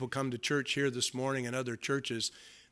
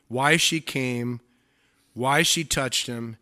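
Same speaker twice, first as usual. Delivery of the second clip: slow, louder listing; slow emphatic; 3 semitones lower, 4 dB louder than usual. Real, not acted. Preacher stressing her confession, slow and weighty. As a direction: weigh each why.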